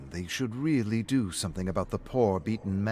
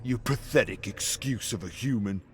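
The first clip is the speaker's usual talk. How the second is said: sneering fan; 2 semitones higher, 6 dB louder than usual. Acted. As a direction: spit the insult at him. Fan insults David in alley.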